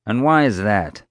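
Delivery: mildly irritated challenge; annoyed. A narrator's reading. Security chief challenges Dad's advice, mildly annoyed. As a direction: ask with a touch of annoyance. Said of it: "gave him an annoyed glance".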